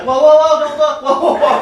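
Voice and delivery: high, loud panicked protest; mock alarm. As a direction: imitate a startled woman crying whoa. Imitates alarmed woman at the gym.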